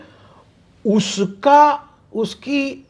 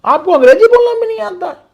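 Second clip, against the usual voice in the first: mocking quote; 13 semitones higher, faster than usual. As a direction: sneer it like the snob. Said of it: "तो उसके लिए कहा था".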